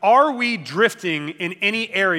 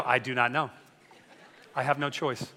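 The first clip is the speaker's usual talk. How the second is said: lower, softer mock-wife voice "Not really feeling it"; mock bored wife; 5 semitones lower, 5 dB quieter than usual. Wife voiced in dinner skit.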